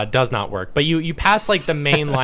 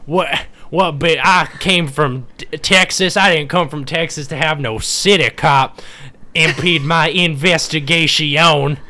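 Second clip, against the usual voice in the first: Southern Texan cop, laughing slightly; lightly voiced Southern cop scolding the city detective; Southern accent. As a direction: grumble like a Texas sheriff. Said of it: "I don't know why he's Southern".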